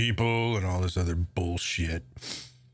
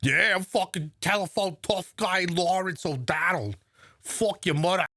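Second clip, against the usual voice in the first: higher, hammed-up Boston tough guy; mock Boston bravado; Boston accent; 8 semitones higher than usual. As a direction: sneer it in a thick Boston accent. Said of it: "this old Boston accent".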